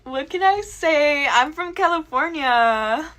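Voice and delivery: put-on slow, drawn-out Valley Girl; Valley Girl accent. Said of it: "I love your Valley Girl accent".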